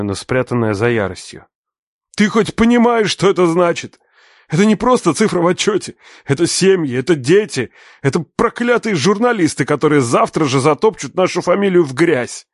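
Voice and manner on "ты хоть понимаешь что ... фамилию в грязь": much higher, louder raging tirade; furious father. Acted. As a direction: shout him down. Father rages at son.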